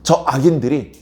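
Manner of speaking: ordinary talk, nothing marked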